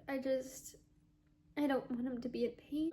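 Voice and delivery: tearful. Real, not acted. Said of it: "I'm gonna start crying".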